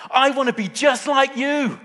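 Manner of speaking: put-on very high, loud child's outburst; acting a small son's joyful declaration at full voice; excited blurt. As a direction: blurt it out like a bursting little boy. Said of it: "then he blurted out".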